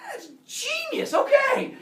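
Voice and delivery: very high, drawn out into a loud burst; acted Zeus, wildly sold on the idea; gleeful approval. Zeus agreeing to the axe cure, gleeful high outburst.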